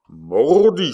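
sharp loud outburst, pitched high; angry exclamation of a hot-headed swordsman; exclaimed oath. A narrator's reading. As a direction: blurt the oath out in anger. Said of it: "s'écria Coconas".

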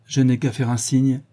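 ordinary delivery, nothing marked

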